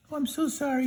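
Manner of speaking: storyteller voicing a high, timid cowardly lion. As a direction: beg way up high as the scared lion.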